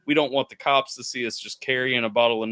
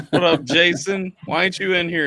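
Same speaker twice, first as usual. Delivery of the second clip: laughing greeting; 6 semitones higher, 4 dB louder than usual. Real, not acted. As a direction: call him in while laughing.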